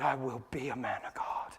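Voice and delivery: whispered promise, soft and breathy; hushed vow to God. Acted. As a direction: whisper it like a private oath. Private vow retold.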